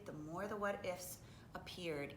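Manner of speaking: ordinary delivery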